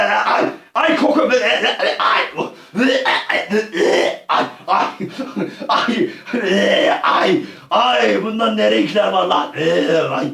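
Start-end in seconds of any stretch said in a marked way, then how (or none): 0.0-8.2 yelping ay ay. Real, not acted. Disgusted wailing.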